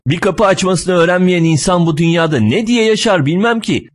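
high-pitched, griping complaint; nagging. Acted. Driver keeps on at the clumsy passenger, nagging. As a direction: nag on with exasperated disbelief. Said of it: "boyuna dırdır ediyor".

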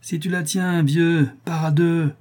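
put-on high, sneering boy; mocking, drawn-out taunt; sniggering. A narrator's reading. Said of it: "dit Tortillard en ricanant".

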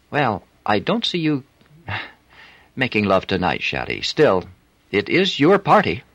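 a flirty French streetwalker; French accent. A narrator's reading. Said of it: "unmistakable French accent".

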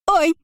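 short high cry; sharp yelp of pain. A narrator's reading. Kiora twists her foot and yelps sharply. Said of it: "вскрикнула она".

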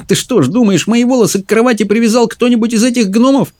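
very high, scoffing question; derisive, incredulous sister; scornful snort. A narrator's reading. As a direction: ridicule the idea in a high-pitched voice. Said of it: "фыркнула Мэлори".

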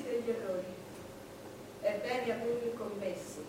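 soft sad reflection. Acted. Sad reflective confession, softer.

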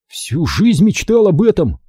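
grumbling. A narrator's reading. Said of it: "буркнул Оби-Ван".

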